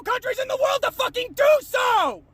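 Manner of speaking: high, angry yell; outraged shout. Real, not acted. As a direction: let outrage lift the voice into a shout. Outrage at own country.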